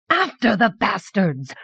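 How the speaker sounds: high, drawn-out battle cry; rally the room, stretching the words. A narrator's reading. Redoubtable queen sends the nobles after the kidnappers, rallying cry. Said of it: "cried redoubtable Queen Jiri".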